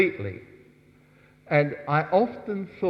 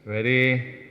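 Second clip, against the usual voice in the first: ordinary talk, nothing marked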